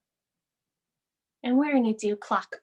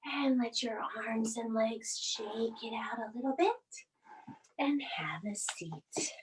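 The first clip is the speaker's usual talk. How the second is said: whisper; 10 dB quieter than usual. Real, not acted. Winding down the class.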